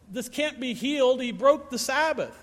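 high-pitched mock protest; incredulous accuser's complaint; mock indignant objection. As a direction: play the scandalized objector, pitch jumping up. Voicing objectors to the healing.

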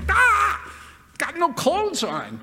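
a shocked yelp at the sight; shocked gasp. Retold or imitated. Preacher reacting to showgirls in Vegas, startled. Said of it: "I look up there and I go".

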